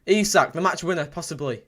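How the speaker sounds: normal talking voice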